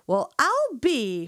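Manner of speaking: hammed-up country drawl; best country accent. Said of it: "That was my best country accent".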